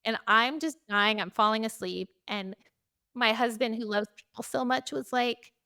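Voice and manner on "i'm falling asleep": normal talking voice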